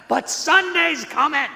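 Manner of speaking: high, loud shouted reply; congregation yelling back. Retold or imitated. Congregation answering their pastor, loud shouted response. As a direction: shout it back like the whole church. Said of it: "everybody would yell back at him".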